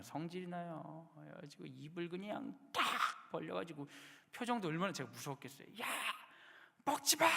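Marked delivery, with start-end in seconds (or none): 5.8-7.3 sharp, high, loud yell of a startled father; scary yell. Retold or imitated. Father yelling at his baby chewing a box. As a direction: bark it out to stop the baby chewing. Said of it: "이러면서 무섭게".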